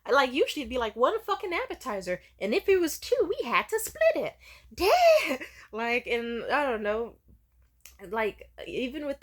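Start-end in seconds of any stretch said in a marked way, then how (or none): 0.1-4.3 high, loud incredulous complaint; mom's exasperated envy. Retold or imitated. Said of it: "And she was like". 4.7-5.4 high, loud burst of disbelief; loud exclamation. Real, not acted. Reacting to mom's story.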